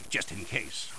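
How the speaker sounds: very soft, low aside; hushed, low afterthought; quiet aside. Acted. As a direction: say it under your breath.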